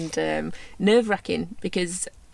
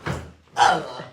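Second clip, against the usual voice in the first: laughing; 2 semitones higher, 9 dB louder than usual. Real, not acted. Laughing tease.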